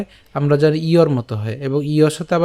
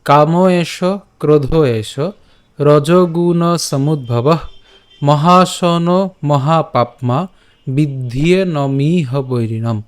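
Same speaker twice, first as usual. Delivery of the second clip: verse recitation; much slower than usual. Shown as a demonstration. Teacher recites the verse slowly to students. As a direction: recite each phrase slowly and clearly.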